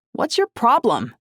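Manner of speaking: high, loud, slow snap; snapping. A narrator's reading. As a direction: snap at your brother. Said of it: "I snapped at him".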